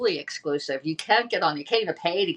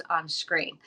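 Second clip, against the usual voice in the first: usual speaking voice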